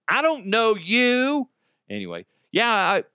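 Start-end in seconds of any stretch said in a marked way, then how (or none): 0.1-1.5 high, slow, sing-song; playful high-pitched brush-off; mock high denial. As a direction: stretch it out high and silly. Repeats joke in high voice.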